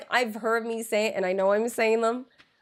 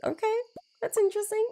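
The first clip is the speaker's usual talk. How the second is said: mock polite; 11 semitones higher than usual. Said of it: "I'm like".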